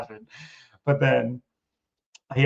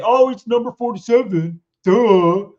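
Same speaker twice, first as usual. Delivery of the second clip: high, dismissive mock voice; mocking know-it-all; 9 semitones higher, 6 dB louder than usual. Mocking what everybody would say, high and dismissive. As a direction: sneer it like it's obvious. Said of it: "Everybody would just say".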